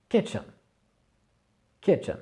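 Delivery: Kitchen with KIT- stressed, -chen murmured; accent sur KIT- de kitchen. Shown as a demonstration. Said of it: "accentuation sur la première syllabe".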